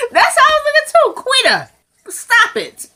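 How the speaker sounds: very high, squealed exasperation; exasperated outburst. Real, not acted. Viewer begging Quita to stop, exasperated. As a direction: squeal it, then drag out the scolding.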